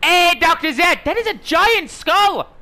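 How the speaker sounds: high, loud exclamation of amazement; big high-pitched gawk; excited call. Real, not acted. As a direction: call out to the doctor, voice shooting up. Excitedly calling out to game doctor.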